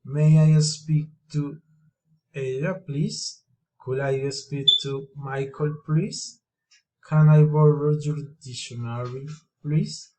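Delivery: each "please" swings up at the end; polite request, lifting the pitch sharply on "please"; rising request tune. Shown as a demonstration. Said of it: "rising intonations".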